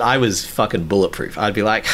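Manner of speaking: ordinary talk, nothing marked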